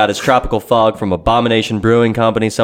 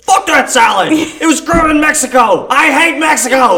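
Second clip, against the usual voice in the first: high, loud, put-on angry rant; mock furious commenter; ranting troll voice; 6 dB louder than usual. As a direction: mimic a ridiculous online ranter, high and loud. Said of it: "And they're like".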